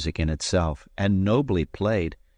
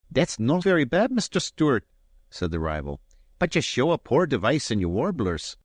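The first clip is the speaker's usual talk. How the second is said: higher, grandly polite Highland gentleman; Robin's stiff politeness, pitched higher; Robin, courteous; 6 semitones higher than usual. A narrator's reading. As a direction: offer the advantage with lofty courtesy. Robin mocks Allen's playing.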